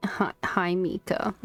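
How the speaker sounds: ordinary talk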